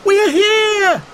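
high loud call across the field; calling out to the rescuers; shouted. A narrator's reading. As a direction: yell it to be found. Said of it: "they shouted".

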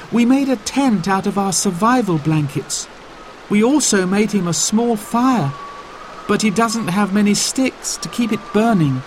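lightly voiced worried young boy; worried child. A narrator's reading. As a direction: tell it quickly, anxious for Dad. Child explaining in story.

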